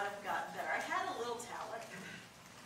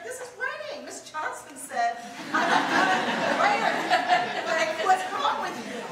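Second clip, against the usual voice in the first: high, loud mock-indignant outburst with a laugh; 10 semitones higher, 14 dB louder, slower than usual. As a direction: play the offended student, half laughing. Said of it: "I was like".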